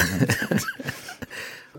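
bursts into a high laugh; embarrassed laugh at being asked for an accent. Real, not acted.

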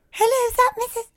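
very high-pitched prank caller; squeaky mock receptionist greeting; put-on caller voice. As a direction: try a silly high phone voice. Retries the caller voice, much higher.

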